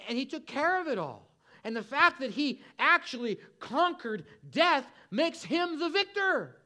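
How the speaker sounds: full-force preaching. Acted.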